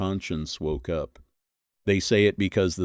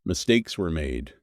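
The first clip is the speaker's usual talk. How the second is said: normal talking voice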